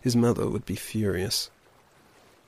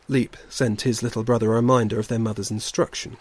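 plain reading voice

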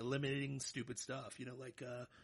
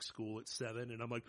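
normal talking voice

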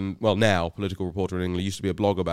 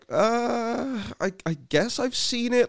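timid reply; 13 semitones higher, 3 dB quieter, slower than usual. Retold or imitated. Startled caller answering Bannon.

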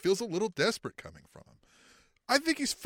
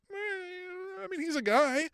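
high, unimpressed "Eh, I mean, he's a guy"; dismissive high shrug; 13 semitones higher, slower than usual. Retold or imitated. Podcaster mocking fans' verdict, dismissive high shrug. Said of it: "And we went".

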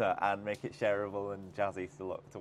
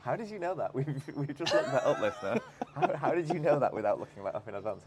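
laughing while asking, louder; laughing disbelief; 5 semitones higher, 5 dB louder than usual. Real, not acted. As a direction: laugh at how he knew that. Amazed co-host asks, laughing.

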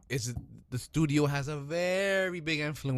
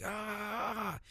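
high, soft mock scream of pain; a held-back "aah", acting out burning hands; imitated pained cry; 9 semitones higher, 6 dB quieter than usual. Retelling Superman absorbing heat into his hands, high mock cry. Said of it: "His hands are fucking burned".

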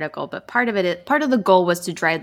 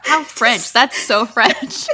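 slow, laughing drawl; amused, laughing slowly; 5 semitones higher, 4 dB louder, much slower than usual. Real, not acted. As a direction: savour the joke through a laugh. Laughing at cigarette joke.

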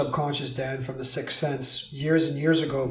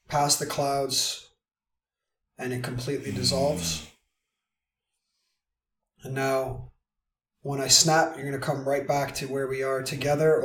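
guided meditation; 6 dB quieter than usual. Acted. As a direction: lead them gently through the imagery. Guides a balloon visualization.

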